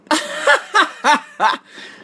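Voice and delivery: high, loud laugh; burst of laughter. Real, not acted. Host laughing at a viewer's comment, high and loud.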